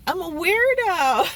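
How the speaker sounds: high, loud mock announcement; playful declaration. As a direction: proclaim it in fun, about to laugh. Hams up a self-label.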